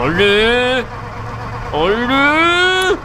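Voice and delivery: high calling-out exasperation; exasperated calling. Real, not acted. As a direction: yell at it to hurry. Frustrated, calling out loud.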